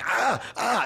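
high-pitched cries of pain; reenacted high yelps from a Charlie horse; mock pained yelps. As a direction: yelp "ah" as if cramp just hit. Acting out waking with leg cramp.

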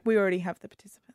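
hushed snooty whisper; whispered quote. Retold or imitated. Snooty host whispering to latecomers. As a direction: whisper it down your nose at them. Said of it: "she's like".

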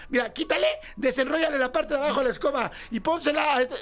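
everyone jumping in with ideas; imagined Mexican crowd rigging a fix, high and excited; excited Mexican fixers. Pretend. Imagined Mexicans improvising rescue.

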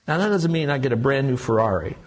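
ordinary talk, nothing marked

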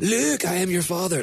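imitating a comic Scottish movie villain; high, mock-dramatic Scottish accent. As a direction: quote it in a hammed-up Scottish accent. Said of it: "And then you go".

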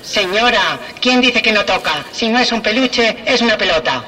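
through a megaphone, fairground barker call; customer's megaphone stuck on fair mode, barker call. Acted. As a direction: bark it like a fairground prize caller over a loudspeaker. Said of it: "Es la tecla feria".

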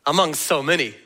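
ordinary talk, nothing marked

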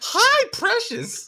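very high, slow squeaky greeting. Pretend. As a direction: stretch it out in a shrill falsetto with a laugh. Mock voice joke after crack.

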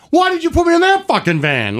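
retold outrage at being put in the van; incredulous outburst. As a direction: throw the question back at him, outraged.

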